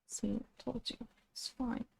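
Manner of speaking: soft, reassuring words to a nervous pet; soft reassurance. Real, not acted. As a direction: soothe the cat, softly. Soothing cat after it jumps.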